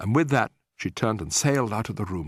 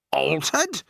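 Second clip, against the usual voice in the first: shouted; 6 semitones higher than usual. A narrator's reading. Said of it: "shouted Mister Jenkins".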